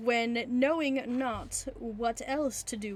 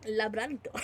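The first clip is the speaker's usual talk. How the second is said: laughing Spanish-accent Labrador; 2 semitones lower than usual. Shown as a demonstration. Reader giving in to a Spanish accent on Labrador. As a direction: roll it out Spanish, giggling. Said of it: "why am I saying it with a Spanish accent".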